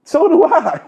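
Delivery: high laughing comeback. Real, not acted. Answering his brother's jab, laughing high. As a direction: toss it back through a laugh.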